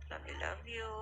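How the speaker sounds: whispering. Real, not acted. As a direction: whisper it tenderly. Whispering to sleeping baby.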